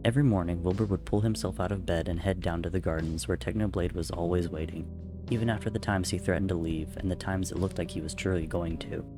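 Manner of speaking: ordinary delivery, nothing marked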